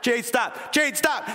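exasperated parent's order rising toward a yell. Retold or imitated. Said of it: "I'm getting louder and louder and louder".

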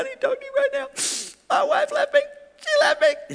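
very high, strained mock crying; losing it. Abandoned husband to the preacher after service, imitated tearful breakdown. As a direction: squeal it out as if sobbing. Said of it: "He's just losing it".

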